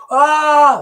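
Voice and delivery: high, loud drawn-out scream; loud mock scream of pain; retold scream. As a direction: scream as if burned, long and high. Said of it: "I'm screaming".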